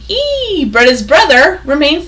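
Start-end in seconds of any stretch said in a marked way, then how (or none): 0.1-0.6 high squeaky E call. Acted. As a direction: say E up high and soft. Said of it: "He just says his name".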